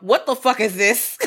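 slow, indignant demand; outraged review. Acted. Co-host acting out a one-star Yelp review, slow outrage. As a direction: spell out the outrage slowly. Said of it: "leaving a one-star Yelp".

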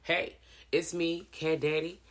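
normal talking voice

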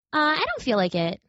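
small reluctant rabbit voice; little toy rabbit voice. Acted.

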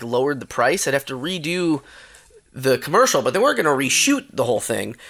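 normal talking voice

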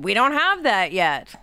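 ordinary delivery

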